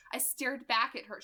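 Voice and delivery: usual speaking voice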